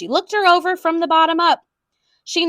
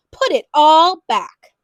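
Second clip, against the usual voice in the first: stern order; 3 semitones higher, 6 dB louder, slower than usual. A narrator's reading.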